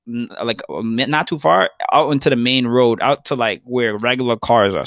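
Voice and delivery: usual speaking voice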